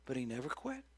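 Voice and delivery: quiet, reflective restatement. Acted. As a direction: let it sink in gently. Quiet repeat of the punchline.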